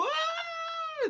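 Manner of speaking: imitated very high childish wail; friends' crying wail. Friends panicking beside the crying boy, a very high wail. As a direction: wail along like the frightened friends. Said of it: "they go".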